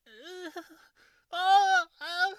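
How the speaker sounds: high moans bursting into loud ones; imitated moaning. Storyteller showing what Ralph would just go in class, imitated moaning. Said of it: "he would just go".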